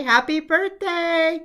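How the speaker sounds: high, loud, drawn-out happy birthday; a slow, beaming birthday cheer; cheery birthday call. Real, not acted. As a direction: celebrate the magazine out loud. Cheering the magazine's fortieth birthday.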